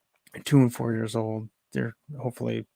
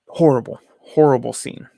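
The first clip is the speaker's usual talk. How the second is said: loud exasperation; 8 dB louder than usual. Real, not acted. Describing the flood and the alarm going off.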